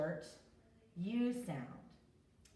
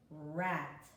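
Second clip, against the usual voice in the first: ordinary talk, nothing marked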